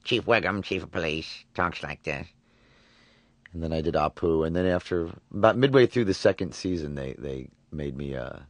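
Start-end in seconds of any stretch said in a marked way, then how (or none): 0.0-2.3 a light hint of the police chief voice; Chief Wiggum voice. Shown as a demonstration. Actor demonstrates Wiggum for the host, lightly voiced. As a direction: introduce yourself as Wiggum. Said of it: "The next week I did Wiggum".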